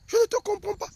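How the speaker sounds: very high, loud mock-wife cry; exasperated wife. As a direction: throw it out high like a fed-up wife. Voices wife's complaint.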